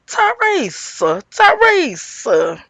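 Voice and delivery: high, slow, drawn-out name; long high scolding call; calling her out. Retold or imitated. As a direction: stretch the name out in mock reproach. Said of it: "do I have to call you out".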